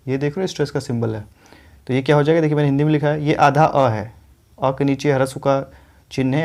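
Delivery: ordinary talk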